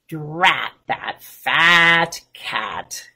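low, drawn-out storybook sign-off; slow closing refrain. Acted. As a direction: stretch out each word to close the story. Slow closing line of the book.

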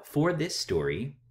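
usual speaking voice

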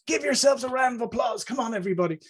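high, projected call for applause; rally the room like a visiting executive; American VP. Retold or imitated. Visiting VP urges Irish staff to clap, imitated rallying. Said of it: "one of the American V Ps came over".

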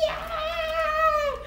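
high happy yay; excited cheer. Real, not acted. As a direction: squeal it with joy. Ice cream is ready.

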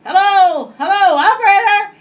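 high, loud squawked parrot cry; squawking parrot yell. Retold or imitated. Parrot squawking for the operator, loud and high. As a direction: screech it out like a parrot calling. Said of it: "the parrot would yell".

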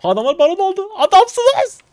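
very high, loud cheering shout; excited cheer. Real, not acted. Streamer cheers his teammates for taking baron, loud. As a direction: yell with joy for the team.

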